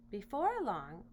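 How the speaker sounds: ordinary delivery, nothing marked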